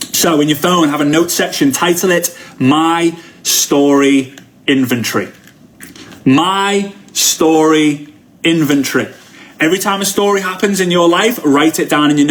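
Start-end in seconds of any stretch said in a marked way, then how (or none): 2.6-5.3 slow dictation. Shown as a demonstration. Dictating the note title. 6.2-9.1 slow, deliberate naming; slow and emphatic title; spelled-out slow title. Shown as a demonstration. Repeats title slowly.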